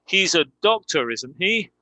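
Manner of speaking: tag "isn't he" lifts upward as a real question; rising intonation. Shown as a demonstration. Teacher demonstrating a real question to students, rising intonation on "isn't he". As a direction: make "isn't he" rise, genuinely asking. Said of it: "with rising intonation".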